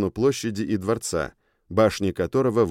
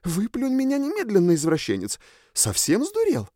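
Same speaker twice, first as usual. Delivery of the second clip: put-on high, shrill outraged girl voice; возмущённая сова; 11 semitones higher than usual. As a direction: scold him, high and indignant. Owl spirit scolds telepathically. (высокий возмущённый голос духа)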